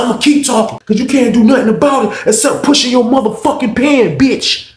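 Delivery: high, heated mock defiance; acted angry taunt. Inmate defying officer who writes charges, angry.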